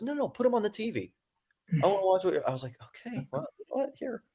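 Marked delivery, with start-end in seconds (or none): 0.0-2.5 high, soft imitation of an insistent grandma; soft, raised-pitch quoted insistence; lightly voiced grandma. 2.8-4.2 very soft, high retold giving-in; small, high, tentative voice; acted hesitant compliance. Acts out giving in to grandma.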